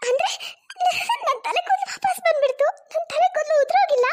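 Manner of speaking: high, excited disbelief; joyful surprise. Acted. Wife finding her hair back after mask removed, high excited surprise. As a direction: gasp with relief that the hair is back.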